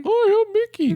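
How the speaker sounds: very high squeaky cartoon mouse; Mickey Mouse voice. Shown as a demonstration. Co-host asked for Mickey, so a very high cartoon mouse voice. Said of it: "And just Mickey sound like".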